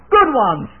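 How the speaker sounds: put-on children yelling. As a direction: yell it like eager children. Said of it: "every one of them yelled out".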